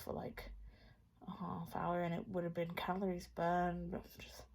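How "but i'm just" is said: tired whisper. Real, not acted. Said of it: "so tired".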